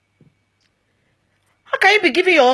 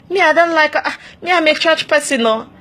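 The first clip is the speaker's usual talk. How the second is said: higher, mock-prim refusal; prim churchy woman; 6 semitones higher than usual. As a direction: play a pious woman brushing it off.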